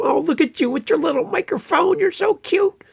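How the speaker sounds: high, cooing mom voice; sugary mom teasing a little microphone; doting mom. Retold or imitated. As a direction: coo like a doting mom over a baby. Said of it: "I'm not your mom".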